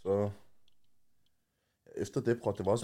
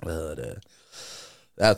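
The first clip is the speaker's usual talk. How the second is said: ordinary delivery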